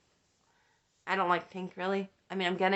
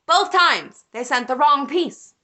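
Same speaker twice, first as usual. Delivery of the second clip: loud, higher-pitched indignation; exasperated outburst; 4 semitones higher, 6 dB louder than usual. Real, not acted. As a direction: burst out in disbelief at the mix-up. Outraged punchline of the mix-up.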